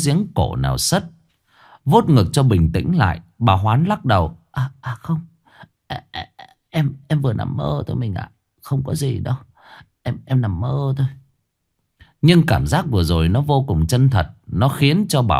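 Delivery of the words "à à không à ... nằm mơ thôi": soft, stammering, still breathless; calm her husband while still shaken; shaken, hushed reassurance. Acted. Mrs Hoán telling her husband it was only a dream, soft and shaken. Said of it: "Bà Hoán thở hổn hển".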